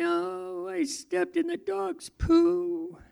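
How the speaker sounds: put-on child voice.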